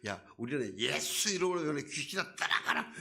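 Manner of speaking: imitating a preacher yelling at demons; mock shouting exorcist. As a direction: ham up a noisy command to the spirits. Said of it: "소리를 막 지르거든요".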